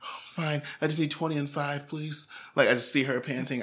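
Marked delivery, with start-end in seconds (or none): 0.0-2.3 understated nervous mom. Retold or imitated. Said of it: "I wish I would have given Donna a voice".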